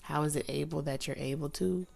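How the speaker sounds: quiet self-correcting mutter; quiet muttered retry. Real, not acted. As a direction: mutter the retry under your breath. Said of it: "See, I can't even talk".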